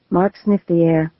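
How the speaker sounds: plain reading voice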